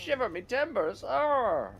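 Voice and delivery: very high, loud pirate squeal. Retold or imitated. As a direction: yell it like a cartoon pirate. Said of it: "and then he goes".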